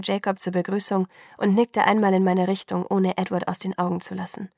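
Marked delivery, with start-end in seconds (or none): none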